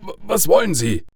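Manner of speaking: high, halting, stammered submission; frightened stammer. A narrator's reading. Father gives in to the caller, frightened stammer. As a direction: stumble over the first words, scared.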